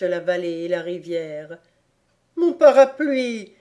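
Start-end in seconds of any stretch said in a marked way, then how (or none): 2.4-3.5 loud, grumbling complaint; a grumpy man mourning his umbrella; more and more sullen. Acted. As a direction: sulk it out loudly. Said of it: "reprit Reb de plus en plus rechigné".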